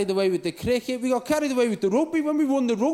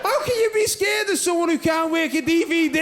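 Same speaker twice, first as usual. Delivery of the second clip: mocking shout; 7 semitones higher than usual. Real, not acted. Comic punchline shouted.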